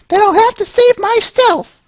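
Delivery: very high put-on falsetto heroine; terrible female voice. Pony heroine defying the villain in falsetto. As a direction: squeak it out like a brave girl. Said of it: "my terrible female voice".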